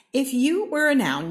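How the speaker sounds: high, loud announcing refrain; bright proclaiming refrain; excited refrain. A narrator's reading. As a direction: call it out like a title.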